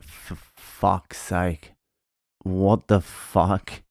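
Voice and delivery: slow quiet swearing, defeated and disgusted; exasperated mutter. Retold or imitated. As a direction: groan it out quietly like you can't believe the mess. Finding a million holes.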